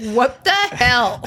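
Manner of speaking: slow, drawn-out mock astonishment; retold disbelief. Woman hearing he's been there twenty minutes, retold bafflement. Retold reaction to lurking boy.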